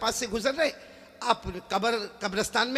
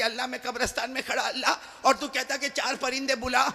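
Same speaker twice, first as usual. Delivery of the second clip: normal talking voice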